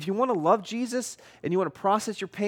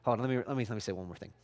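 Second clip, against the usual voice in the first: hushed aside; 7 semitones lower, 8 dB quieter, much faster than usual. Retold or imitated. Preacher voicing Paul, dropping quiet.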